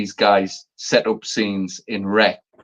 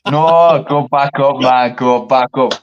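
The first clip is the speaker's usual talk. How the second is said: louder, pressing the point; eager, louder demand; insistent protest; 3 semitones higher, 5 dB louder, slower than usual. Real, not acted. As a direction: keep pushing them to go back up. Insisting they scroll back.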